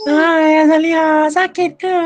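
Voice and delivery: mock a boy talking like a woman; teasing sing-song falsetto greeting; effeminate voice. Shown as a demonstration. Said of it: "kamu cakap macam perempuan".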